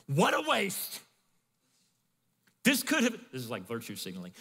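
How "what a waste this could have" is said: loud, high indignant outburst. Retold or imitated. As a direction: scoff at the wasted perfume. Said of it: "some who said to themselves indignantly".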